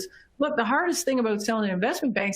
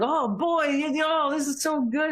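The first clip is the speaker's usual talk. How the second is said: higher, eager thrilled voice; excited bankers; 6 semitones higher than usual. Retold or imitated. Bankers thrilled by the demo, imitated high excited gushing. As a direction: gush like bankers seeing a great demo. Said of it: "everybody got really excited".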